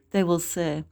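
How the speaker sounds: usual speaking voice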